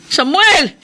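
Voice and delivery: loud worried call into the dark. Acted. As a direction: shout his name to find him. Searching for missing husband, calling. (malakas na pagtawag, nag-aalala)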